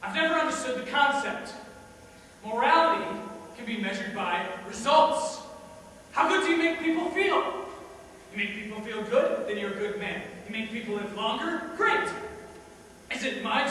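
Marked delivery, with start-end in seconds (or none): 0.0-7.7 loud, raised-pitch declaiming; forceful, high rhetorical attack; defiant argument. Acted. Defiant argument about morality and results. 11.8-12.1 high, loud exclamation; loud, high-pitched approval; emphatic triumph. Acted. Triumphant answer to own rhetorical question.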